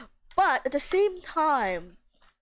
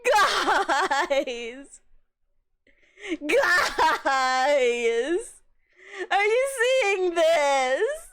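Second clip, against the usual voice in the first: can't believe what's on screen; excited shouting; 4 semitones higher, much slower than usual. Real, not acted. Streamer shouting to chat, thrilled by the scene.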